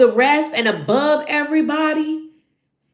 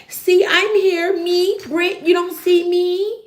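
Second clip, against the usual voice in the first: higher, whiny mock pleading; hammed-up clingy begging for attention; a jealous friend whining for notice, higher and whiny; whiny needy Britt; 5 semitones higher than usual. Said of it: "Britt was like".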